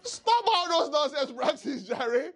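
high laughing cry of a rebuke, slowed. Real, not acted. Preacher laughingly scolds the congregation, high and slow. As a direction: laugh it out then scold slowly through the laughter.

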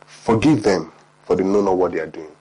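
hushed forgiving prayer; gentle plea. Retold or imitated. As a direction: speak it softly, like a prayer. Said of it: "Jesus the Father says".

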